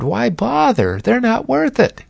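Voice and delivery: high, quick, wheedling tempter voice; a sly devil whispering doubts, pitched high; Satan tempting Jesus. Retold or imitated. Satan tempting Jesus in the garden, high and wheedling. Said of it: "Can't you almost hear Satan saying".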